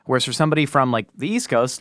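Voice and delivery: ordinary delivery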